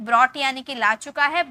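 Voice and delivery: normal talking voice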